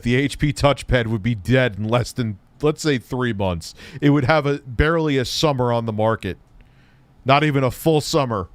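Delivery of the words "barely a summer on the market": usual speaking voice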